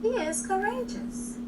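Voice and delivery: ordinary delivery, nothing marked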